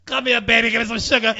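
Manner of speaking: loud creepy come-here call; haunted house actor. Retold or imitated. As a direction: lure the kid in a creepy shout. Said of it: "Look at her".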